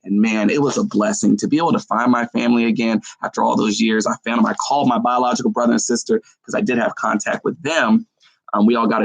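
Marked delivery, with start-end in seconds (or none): none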